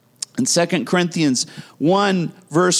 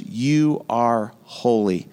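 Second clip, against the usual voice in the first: voicing God; 6 semitones lower, much slower than usual. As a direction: speak as God pronouncing it, hushed and deliberate. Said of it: "God says".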